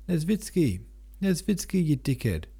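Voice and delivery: calling out high over a crowd; mock yell across the crowded bridge; rough call. A narrator's reading. Denisov hails Nesvitsky through the crowd, calling out high. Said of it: "came a rough voice from behind him".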